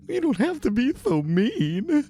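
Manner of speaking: very high, pouty whine; a hurt little man sulking at the rogue; whiny Floon. Acted. Floon complains.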